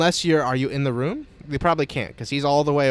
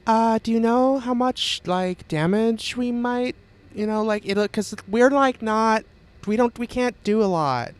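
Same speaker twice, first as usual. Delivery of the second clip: high, halting cultist calling up; worried shouting voice; 8 semitones higher than usual. Acted. Another cultist asks about the damage, worried calling. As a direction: call up anxiously, pitched well above normal. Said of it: "you hear another voice shout out".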